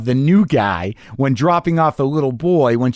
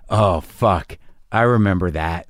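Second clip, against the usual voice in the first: lower, resigned admission of guilt; guilty recognition; 6 semitones lower than usual. Retold or imitated. Host imagining being caught by a jealous husband, resigned and guilty. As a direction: sink into a guilty oh fuck. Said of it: "I was completely ready to be like".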